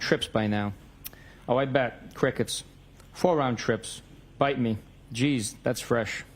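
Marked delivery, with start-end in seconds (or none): none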